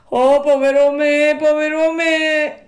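high, loud puppet lament; wailing cry. A narrator's reading. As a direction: cry out in self-pity, drawing out the end. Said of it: "gridò Pinocchio".

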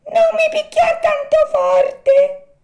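very high, slow, pleading little voice; tiny thin voice. A narrator's reading. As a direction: squeak it like a tiny voice inside the wood. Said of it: "una vocina sottile sottile". (vocina sottile sottile, acutissima e supplichevole)

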